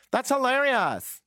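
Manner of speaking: high, slow mock delight; sarcastic. Real, not acted. Sarcastic reply to silence.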